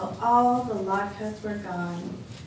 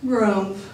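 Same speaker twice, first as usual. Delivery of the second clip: short grumbling bear grunt, louder; a louder bear's grumph; 5 dB louder than usual. Acted. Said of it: "growled Old Bear".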